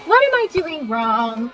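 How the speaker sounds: high, exasperated complaint; frustrated whine. Real, not acted. Frustrated at reading game.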